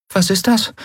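very high, small character voice; Nelen. A narrator's reading. Crewmate asks about the letter, very high little voice. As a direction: pipe the question up high.

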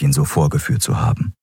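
plain reading voice

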